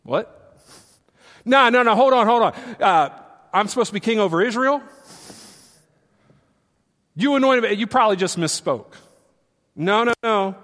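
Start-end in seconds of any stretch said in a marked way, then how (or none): 1.4-10.5 loud, higher, rattled disbelief; startled protest. Acted. David protesting to Samuel, loud and startled. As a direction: hold on, this can't be right.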